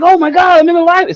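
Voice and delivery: put-on high, amazed kid voice; imitating excited son. Father imitating son finding live event, excited. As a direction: gush in a high, thrilled voice. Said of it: "he was so excited".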